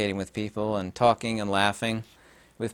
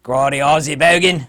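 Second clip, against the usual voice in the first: loud, higher hammed-up Aussie voice; mock Australian accent; 4 semitones higher, 10 dB louder than usual. Showing his favorite Aussie slang term, mock Australian accent. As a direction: belt it out in broad, bad Australian. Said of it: "my new favorite Aussie slang term".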